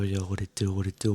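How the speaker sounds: normal talking voice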